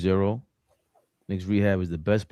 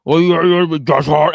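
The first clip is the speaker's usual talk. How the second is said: loud, high-pitched imitation of a rant; mock rant; 8 semitones higher, 13 dB louder than usual. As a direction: blurt it out like someone ranting, stumbling into the name. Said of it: "he went on a rant in his car".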